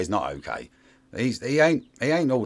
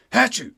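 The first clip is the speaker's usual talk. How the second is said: mock sneeze; 10 semitones higher, 6 dB louder than usual. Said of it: "you go in there and you go".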